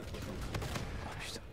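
breathless, voiceless oh putain; whispered swear. Real, not acted. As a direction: whisper it under your breath. Grenade moment, whispered swear.

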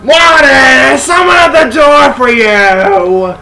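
sing-song shout up the stairs as mom. Retold or imitated. Mother calling Morty to the door, drawn-out call.

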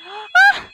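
sudden, very high, loud scream of fright; startled scream. Acted. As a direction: shriek in surprise. Wife sees figure, screams.

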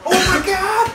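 high-pitched cry of disbelief; appalled cry. Real, not acted. Player reacting to a missed shot, appalled cry. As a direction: wail at the blown chance.

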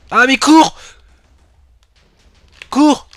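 panicked yell, high and loud; yelling from the sidelines. Real, not acted. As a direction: scream at him to run. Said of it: "il m'a fait peur".

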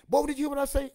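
high, loud stern scold; scolding grandma. Retold or imitated. Grandmother scolding grandson about shoes. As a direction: put the boy in his place. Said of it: "grandmother scolding".

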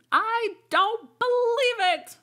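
very high, loud, slow insistent bird voice; accusing pigeon. A narrator's reading. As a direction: nail her as a serpent, pigeon-style. Pigeon voice, disbelieving.

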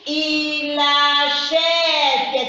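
very slow, chanted "Il achète"; drawn-out teacher's model reading of "achète"; syllable by syllable. Shown as a demonstration. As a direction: hold each syllable of "Il achète" on a level tone. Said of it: "On lit les tout".

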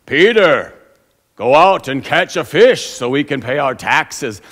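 loud put-on solemn voice; booming mock-biblical Jesus; mock grand Jesus. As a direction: ham up a movie Jesus. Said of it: "I don't imagine Jesus like".

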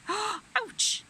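gasp, then a high yelp; crying out. A narrator's reading. Hedgehog hurt by the cold snowball, crying out. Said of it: "he cried".

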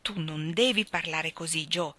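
ordinary delivery

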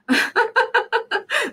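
high delighted giggle; burst of laughter. Real, not acted. Laughs at own bot.

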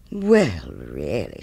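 mildly offended miller, dropping low on "really"; huffy "well", then a low, disapproving fall; affronted. Acted. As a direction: act put out, letting the pitch sink.

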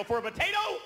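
high, incredulous mock outburst; mock snapping. Fed-up customer snapping at waiter, high incredulous outburst. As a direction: snap back in high disbelief. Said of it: "Finally, I snap".

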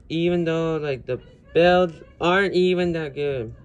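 real loud, emphatic outburst; half yelling. Real, not acted. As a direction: get carried away and nearly yell. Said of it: "Sorry if I'm yelling".

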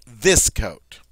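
THIS punched out loud before coat; emphatic stress. Shown as a demonstration. French teacher emphasizing this coat over that one, stress on THIS. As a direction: lean hard on THIS, coat falling after it. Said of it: "Say I want to emphasize".

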